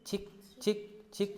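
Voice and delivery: high chhik chhik like anklet bells; light anklet jingle sounds; anklet jingle imitation. Shown as a demonstration. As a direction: jingle it for the class. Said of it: "पायल की ध्वनि कैसे बजती है".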